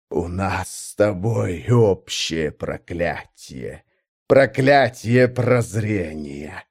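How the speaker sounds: very slow, deep godlike rumble; a throaty primarch's growl, drawn out. Acted. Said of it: "primarch continues speaking". (медленный глубокий рык примарха)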